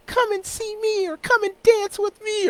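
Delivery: very high, put-on come-hither voice; mock the flyer models in a high squeal; high-pitched parody of an inviting promo model; alluring party-ad model. Mimics models on party ads.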